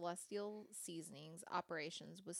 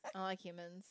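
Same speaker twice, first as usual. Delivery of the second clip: laughing; 4 dB louder than usual. Real, not acted. Laughing refusal of hugs.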